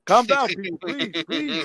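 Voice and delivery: high, pleading calm down; pleading with fans. Acted. Beaten boxer begs his rioting fans, high and pleading.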